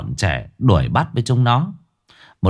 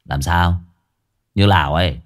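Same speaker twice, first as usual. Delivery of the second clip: low, cocky retort; low-voiced provocation; cocky challenge; 5 semitones lower than usual. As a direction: square up to him, pitched low. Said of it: "Ông sấn sổ bước tới hất hàm".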